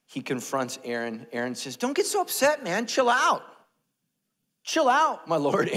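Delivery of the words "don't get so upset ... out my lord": high, loud comic protest; mock indignant shout. Aaron telling Moses to calm down, mock indignant shout. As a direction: shout it like an exasperated excuse-maker.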